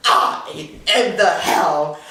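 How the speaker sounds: slow, drawn-out shouted boast; proud declaration. Acted. Declares herself the hell and high water.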